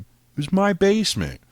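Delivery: high, loud protest; indignant yelp. Retold or imitated. Protesting to staff about his own basement, high and loud. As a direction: squeal it in disbelief.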